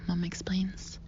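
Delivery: plain reading voice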